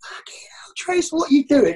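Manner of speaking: hoarse, unvoiced half-whisper of a manager losing it; exasperated manager. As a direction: hiss it in disbelief through your teeth. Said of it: "Gaffer's losing the plot".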